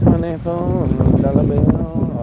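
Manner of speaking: playful, slow elongated repeat of an amount; drawn-out. Pretend. Shopper repeating the order total to himself, drawn-out. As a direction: stretch the words out long and slow.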